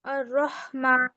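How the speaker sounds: अर्रहमान read carefully with the doubled r; student reading aloud as asked; student recitation. Shown as a demonstration. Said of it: "लाम भी पढ़".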